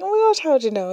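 thrilled at the sister's gift; excited. Retold or imitated. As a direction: squeal it out in surprised delight. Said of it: "And I was like".

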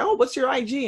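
a hint of a keen woman asking; curious saleswoman. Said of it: "she's like".

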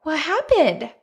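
high-pitched imitation of a consoling husband; retold sympathetic husband. Husband comforts his emotional wife, retold sympathy. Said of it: "He's like".

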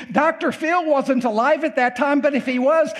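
usual speaking voice

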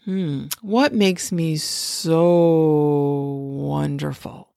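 very slow, every vowel stretched long; dragged-out vowels. Shown as a demonstration. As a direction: draw out each vowel lazily. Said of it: "And you really, like, drag out the vowels".

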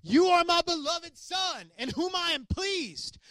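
high, slow, far-off voice of God; slow and exalted, like a voice from the sky; high, drawn-out heavenly proclamation. Retold or imitated. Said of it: "this voice".

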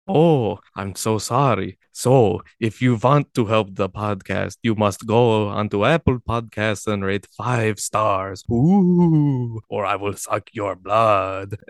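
slow, eerie vampire-ish drawl; spooky voice. Pretend. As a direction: creep them out like a vampire host. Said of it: "can you do the outro in your spooky voice?".

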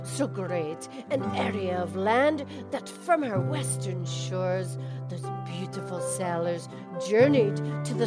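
put-on Scottish accent recitation. Cow recites [name]'s Atlantis in a mild Scottish accent. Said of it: "You do it again, please".

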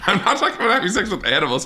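fast, high, laughing denial; laughing protest. Real, not acted. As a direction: protest through laughter, rushing.

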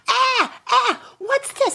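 high startled yelp; startled coyotes. Acted. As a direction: jump up in pitch, bewildered. Coyotes startled by spitting fish.

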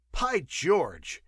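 loud, high exclamation; burst of amazement. A narrator's reading. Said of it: "in a new burst of amazement".

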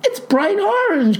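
very high, hammed-up astonishment; shocked cry. A narrator's reading. Clerk sees yet another hair color, shocked cry. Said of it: "cried the gal".

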